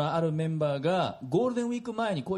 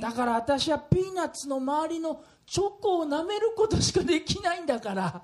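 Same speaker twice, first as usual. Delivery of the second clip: lightly voiced old lady, slow then higher; grandma voice; 7 semitones higher, slower than usual. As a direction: play a sweet elderly grandma. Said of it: "するとおばあちゃんこう答えました". (おばあちゃんの声)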